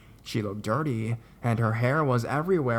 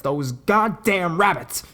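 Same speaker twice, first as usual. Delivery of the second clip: loud, high, drawn-out angry outburst; angry ranting; 6 semitones higher, 7 dB louder, slower than usual. A narrator's reading. Disturbed girl rants into the camera about rabbits, loud and angry. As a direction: spit it out slowly and forcefully, pitched up.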